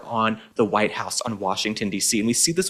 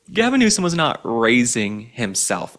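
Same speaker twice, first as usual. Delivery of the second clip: ordinary talk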